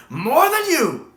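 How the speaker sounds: slow, high, loud and drawn out; savored retort. Retold or imitated. Fan relishing McGann's line, drawn out slow, high and loud. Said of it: "hear McGann say".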